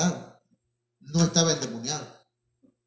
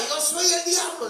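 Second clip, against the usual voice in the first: high imitation of a possessed scream; retold shout; 12 semitones higher, 4 dB louder than usual. Possessed young man to the brothers, shouting high. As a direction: cry it out like someone possessed. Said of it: "y gritaba".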